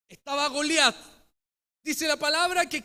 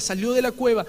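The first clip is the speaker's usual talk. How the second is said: ordinary talk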